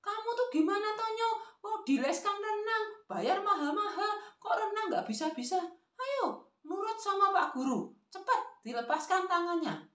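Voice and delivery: high-pitched nagging grandma; acted, exasperated high-pitched granny; scolding grandmother. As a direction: push an unwilling child in a high scolding voice. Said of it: "sang nenek menambahkan lagi".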